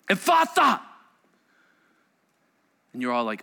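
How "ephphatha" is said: high, loud ringing command; a loud, ringing Aramaic command; Jesus's command. Acted. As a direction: call it out like a command that opens ears. Jesus speaks the healing command.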